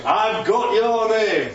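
slow, high, emphatic summons; intense call. Acted. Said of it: "it's intense".

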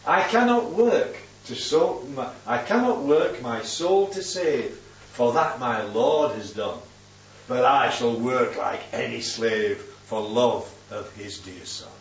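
slow, measured verse reading, dropping low by the end; poem recitation. Acted. Preacher reciting a little poem to his congregation, slow and measured. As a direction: recite it slowly, line by line.

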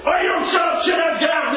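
high, loud mock shout at the kids; imitating a teacher yelling at pupils to shut up and get out; angry teacher shout. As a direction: bark it like a fed-up teacher who silences the class. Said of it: "what does the teacher, the member of staff do?".

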